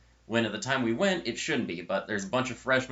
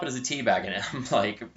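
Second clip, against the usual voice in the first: usual speaking voice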